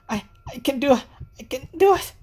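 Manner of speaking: high, stammering attempt at courage; shaky self-encouragement. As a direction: try to believe it while panicking. Senri voiced.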